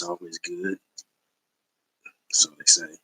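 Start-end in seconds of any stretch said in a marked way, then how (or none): 2.3-3.0 breathy sideways whisper; conspiratorial whisper to viewers; whispered aside. Real, not acted. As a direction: drop to a whisper as if sharing a secret. Wry aside about collector lore.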